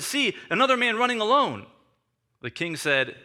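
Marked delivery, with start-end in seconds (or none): 0.0-1.7 higher-pitched lookout's call. A narrator's reading. Watchman calls to the gate about a runner, mildly calling out. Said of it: "watchman called at the gate".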